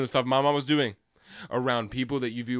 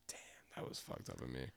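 low whisper to himself; murmuring regret to himself next morning, whispered; 12 semitones lower, 20 dB quieter than usual. Retold or imitated. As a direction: breathe it out in a whisper. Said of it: "I'm like".

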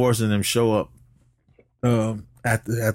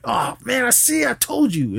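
much higher whiny I-told-you-so; 14 semitones higher than usual. Retold or imitated. [name] gloating at Kid and Play, high and whiny. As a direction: squeal it like a smug know-it-all.

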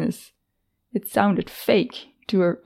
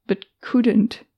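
ordinary delivery, nothing marked

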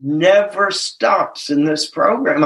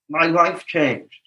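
ordinary talk

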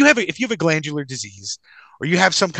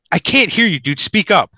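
higher, louder mock complaint; loud hard-of-hearing act; 3 semitones higher, 4 dB louder than usual. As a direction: call out so the whole line hears. Loudly answers the hushed attendant.

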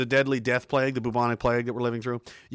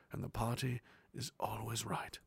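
whisper; 3 semitones lower, 14 dB quieter than usual. Acted. Mock propaganda slogan.

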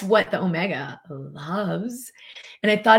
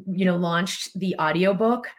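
ordinary talk

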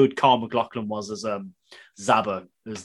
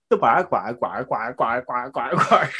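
duck voice; slower than usual. Acted. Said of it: "like an ill duck".

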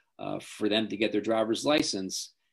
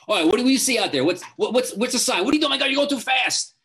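much higher, louder frantic barrage; panicked parent yelling; 13 semitones higher, 7 dB louder, faster than usual. Shown as a demonstration. Parent panicking at teen driver, high loud frantic yelling. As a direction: yell it like a panicking parent in the passenger seat.

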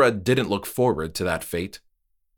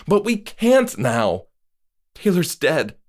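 much higher, louder, slow; desperate protest; 8 semitones higher, 4 dB louder than usual. Acted. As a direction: plead against the rule. Moira protesting, high.

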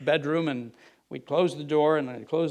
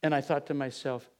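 ordinary delivery

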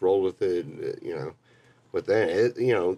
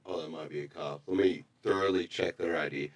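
much softer, lower, wary murmur; hushed suspicious bouncer; 5 semitones lower, 7 dB quieter than usual. Retold or imitated. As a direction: mutter it like a bouncer who suspects a cop. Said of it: "he's like".

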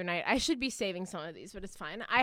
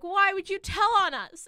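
retold outcry; 10 semitones higher, 8 dB louder than usual. Kids' outcry in retold dream.

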